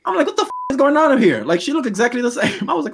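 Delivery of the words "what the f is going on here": shocked disbelief. Retold or imitated. Shocked reaction to ageless actress.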